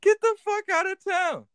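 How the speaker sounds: high-pitched shocked squeal; shocked disbelief. Real, not acted. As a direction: shoot way up in disbelief. Shocked at the news of a death.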